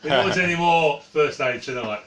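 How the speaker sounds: loud, fed-up protest; exasperated shout. Acted. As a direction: shout it out in mock despair. Reacting loudly to the injury.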